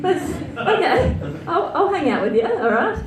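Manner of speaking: giggly high acceptance; mock eager self. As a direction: play it keen but pretend reluctant. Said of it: "I was like".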